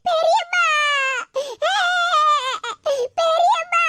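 high-pitched put-on bawling call for help; hammed-up tearful cry; fake wailing. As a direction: wail out for auntie in crocodile tears. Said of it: "இப்படி கண்ணீரை விட்டு கதறிட்டு நிக்க".

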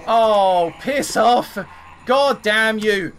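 loud, drawn-out exasperated curse; real frustrated outburst; angry cursing. Real, not acted. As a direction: curse the enemy out, stretching the last words. Cursing at the pursuing enemy.

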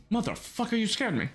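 startled high yelp; jump-scared shriek. Real, not acted. Jump scare in horror game.

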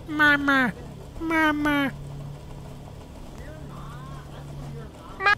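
mock baby babble.

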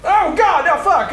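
high loud startled cry; startled yell. Real, not acted. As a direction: jump at the scare. Startled by jump scare.

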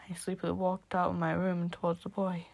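usual speaking voice

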